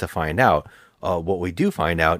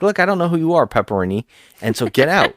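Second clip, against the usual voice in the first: raised, heated telling-off; angry cop; 7 semitones higher, 5 dB louder than usual. Retold or imitated. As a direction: order him out, high and loud. Cop tells Pepperini off.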